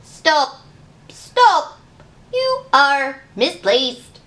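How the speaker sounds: a lightly voiced machine barking orders; droning robot. A narrator's reading. Robot in the library orders the gingerbread man to stop, loud. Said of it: "a cranking, grinding robot droned".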